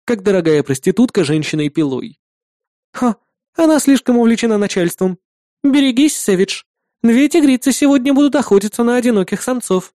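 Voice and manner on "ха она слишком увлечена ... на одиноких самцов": higher-pitched playful woman character; partner Kri, teasing. Acted. Partner warning her colleague about two huntresses, teasing. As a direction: warn him teasingly, voice raised in pitch.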